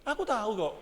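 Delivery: normal talking voice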